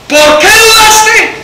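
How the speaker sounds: high, slow, insistent shout; shouted rebuke. Acted. Jesús reprende a gritos a Pedro. (reproche gritado y alargado)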